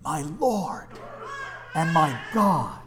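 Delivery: lightly acted, drawn-out awed confession; Thomas confessing. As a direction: let each phrase stand slowly as a confession. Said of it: "then came Thomas's great confession".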